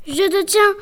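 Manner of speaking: grondement fort et aigu du monstre; grogne-le comme un monstre qui attrape sa proie; grognement menaçant. A narrator's reading. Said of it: "grogna-t-il". (le monstre grogne en saisissant la fillette)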